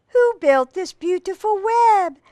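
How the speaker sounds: high, hooting owl voice; owl asking. A narrator's reading. Reader voices the owl's question for children, high hooting tone. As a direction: ask it like a storybook owl, high up. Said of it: "asked the owl".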